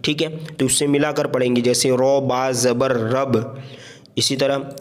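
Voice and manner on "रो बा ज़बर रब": each letter drawn out; slow classroom spelling. Shown as a demonstration. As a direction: spell it slowly for beginners. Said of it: "ऐसे हिज्जे कैसे करेंगे".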